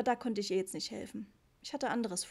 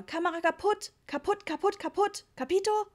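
exasperated yelling; 9 semitones higher, 6 dB louder than usual. A narrator's reading. Papa yelling at the camel owner.

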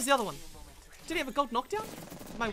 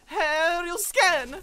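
loud, gleeful announcement; triumphant call-out; 8 semitones higher, 10 dB louder, much slower than usual. Real, not acted. Player announces a favourite ability, triumphant call-out. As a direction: belt it out like a signature move.

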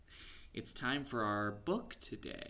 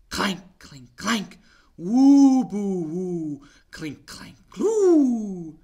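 loud, high eerie sound effects; spooky clanking; 9 semitones higher, 17 dB louder, much slower than usual. Acted. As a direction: make the noises ghostly. Spooky noises in story.

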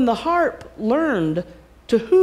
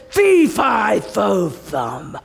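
giant's roar; 3 dB louder, much slower than usual. Acted. Giant bellowing his chant on entering.

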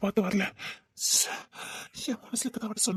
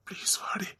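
whispered plea; 3 semitones lower, 6 dB quieter, slower than usual. Acted. Lovesick man begs her to come.

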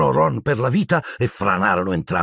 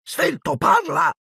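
much higher, snapping demand; impatient caretaker; slower than usual. A narrator's reading. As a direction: caretaker losing patience. Filch demanding an answer. (custode impaziente che esige risposta)

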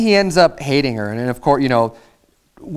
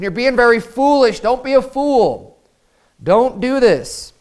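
higher, slow, pleading plea; pleading sister; 7 semitones higher than usual. Retold or imitated. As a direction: beg him, drawing each word out. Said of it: "she's pleading with them".

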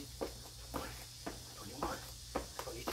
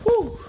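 loud whew; 10 dB louder than usual. Real, not acted. After fifty jumping jacks.

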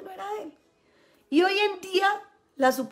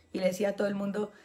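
ordinary talk

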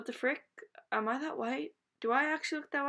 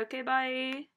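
ordinary delivery

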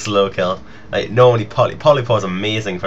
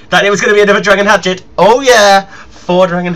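higher, louder and fast, bursting into a cheer; excited shout; 8 semitones higher, 8 dB louder than usual. Real, not acted. Gamer cheering a lucky fourth dragon hatchet drop, excited shout. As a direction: let it explode with real delight.

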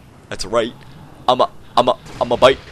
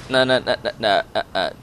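ordinary delivery

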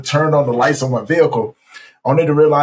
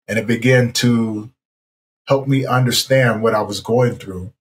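ordinary talk

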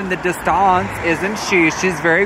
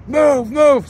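mild mock yell, higher; retold yell; 8 semitones higher, 3 dB louder than usual. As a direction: imitate the old man ordering you aside. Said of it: "he starts screaming".

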